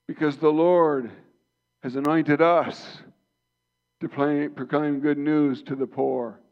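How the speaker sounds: high, loud, soaring preacher's call; rising fervent sermon voice; full-force preaching. Acted. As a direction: lift the room like a revival pulpit.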